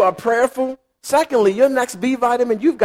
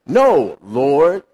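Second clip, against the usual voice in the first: firm refusal; 7 semitones lower, much slower than usual. Retold or imitated. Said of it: "He says".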